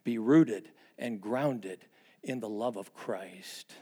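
drawn out, each word weighted; slow emphatic quote. Acted. Pastor quoting Paul to the congregation, slow weighty emphasis. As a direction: lay out every word slowly. Get this.

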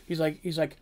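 ordinary talk

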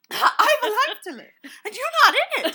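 high, very loud hammed-up outburst; mock-dramatic yell; triumphant shouting. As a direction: shout it with laughter in the voice. Reenacts the triumphant breakup line.

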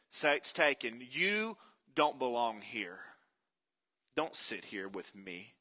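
slow, lightly imitated rural Georgia drawl; Georgia country twang. Bus passengers turning Forrest away, in a Georgia country twang. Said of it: "real Georgia country twang say".

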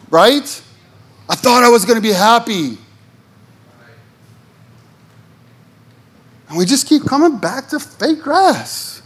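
high, impassioned sermon lines; rising preacher fervor; preaching. Acted. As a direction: drive the point home from the pulpit. Preacher at full force.

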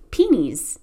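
"peonies" squashed into two syllables, PEE-neez; Indiana pronunciation. Shown as a demonstration. Host shows how Indiana folks say it, "peonies" as two-syllable pee-neez. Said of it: "kind of accent".

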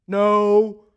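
loud, curt, irritated no; pissed off no. Acted. Fishermen answer the stranger again, a loud irritated no. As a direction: snap it out, tired and hungry. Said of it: "Kind of pissed off a little bit".

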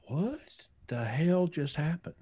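high, breathless whisper of disbelief; stunned whisper. Retold or imitated. Reacting to the agent's sudden change, a disbelieving whisper. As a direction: whisper it as if you can't believe it.

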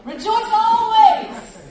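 slow, high, loud and stretched; drawn-out proclamation. Real, not acted. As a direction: stretch every word out like a call. Preaching verse drawn out.

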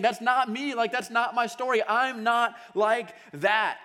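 slow, loud, high protest; denying the affair, shouting to the audience; shouting denial. Retold or imitated. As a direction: shout it at the crowd, refusing the truth. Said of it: "shout out to the crowd".

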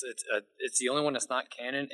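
ordinary talk

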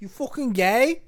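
loud, high mock British jeer; hammed-up British taunt; British accent. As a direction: sneer it like a London lad. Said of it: "Nah, that's more British".

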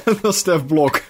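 slow words through laughter; breathy laugh bubbling into the line. Real, not acted. Laughing at Bart greeting Sonja, slowed by giggles.

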